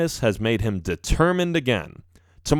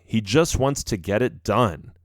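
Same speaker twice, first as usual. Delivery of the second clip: usual speaking voice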